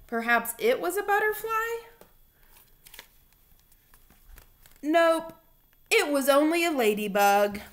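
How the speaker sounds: lively storybook reading voice; reading to children. Acted. Teacher reading a picture book aloud to her boys, animated. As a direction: read it out like story time for little kids.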